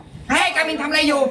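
drawn-out, high-pitched call; high, squeaky-small voice calling someone; high-voiced demo. Shown as a demonstration. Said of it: "เผลอพูดเสียงแบบปกติตอนเสียงต่ำ".

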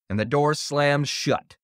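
ordinary delivery, nothing marked

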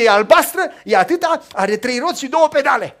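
put-on loud, eager child's bragging; boy loudly boasting to friends about his new bike; excited child. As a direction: show off the toy loudly to friends.

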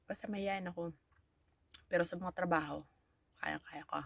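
usual speaking voice

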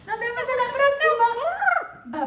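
drawn-out, squeaky goodbye; playful high farewell; high-pitched sign-off. Real, not acted. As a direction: send viewers off way up high, slowly. Sing-out sign-off to viewers.

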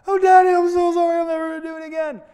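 put-on high, loud, fast little-girl wail; pleading little girl. Daughter begging daddy for forgiveness, high loud wail. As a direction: cry out sorry like a remorseful child.

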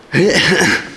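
a real laugh bursting out; hearty laugh; loud burst of laughter. Real, not acted. Laughs after the boss line.

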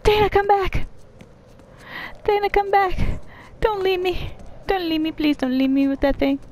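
high, frantic begging; panicked pleading. Real, not acted. Begging character not to leave.

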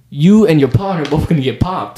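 sling the threat like a street tough, lightly done; mock threat. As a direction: pimp threatening both car wash workers, put-on tough voice. Pimp threatens them, voiced.

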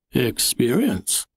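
slow, proud and pleased; beaming. A narrator's reading. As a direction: savour the word proudly. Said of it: "he beamed".

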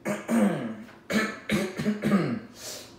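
hammy throat clearing before a reading. Pretend.

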